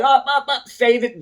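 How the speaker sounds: loud, high mock cutting-off; dismissive officers. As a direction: imitate officers shutting down a protest. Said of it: "they're just like".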